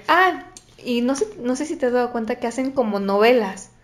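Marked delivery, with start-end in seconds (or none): 0.1-0.4 mock dramatic gasp. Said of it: "entran los jóvenes y dicen".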